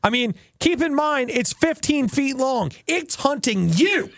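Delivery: high, animated storytelling; excited. Real, not acted. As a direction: hype up the danger. Excited building up the danger.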